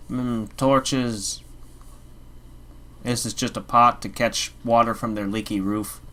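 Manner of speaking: ordinary talk, nothing marked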